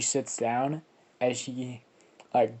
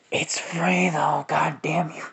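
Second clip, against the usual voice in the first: slow, higher, whispered joke at viewers; mock whispered aside; 4 semitones higher, 3 dB louder, slower than usual. Real, not acted. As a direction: hiss it as a comic aside.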